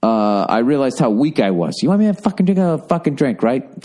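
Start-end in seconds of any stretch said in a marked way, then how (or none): 1.8-3.8 high, exasperated snap with a sarcastic rising right; mock-annoyed comeback to girlfriend pushing a drink; annoyed retort. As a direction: fling it back, irritated and sarcastic. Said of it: "I was really annoyed with her".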